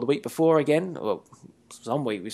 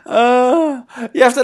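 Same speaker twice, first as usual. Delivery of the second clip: high, louder laugh on the filler; 9 semitones higher, 8 dB louder than usual. Real, not acted. Host laughing as he turns to the viewers, higher and louder. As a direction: still cracking up.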